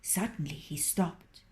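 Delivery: plain reading voice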